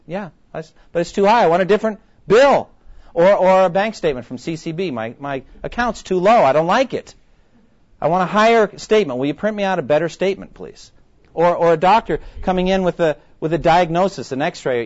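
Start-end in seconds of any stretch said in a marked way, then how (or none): 0.1-2.7 higher, louder whiny demand; petulant customer wanting a new bill. Acted. Acted whiny customer insisting. 4.9-10.9 higher, louder petulant plea; spoiled customer begging for a higher balance; whiny bank customer. Acted. Acted customer demanding better statement.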